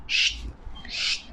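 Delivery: "sh, sh" very soft, unvoiced hiss; sh sound. Shown as a demonstration. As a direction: push air through teeth: "sh, sh". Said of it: "Es como hacer sh".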